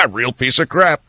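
laugh, then scoff; mock laughing reply. Actor laughing off a fan's praise, mock laughing. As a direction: imitate an actor laughing off a compliment.

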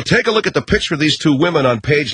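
ordinary delivery

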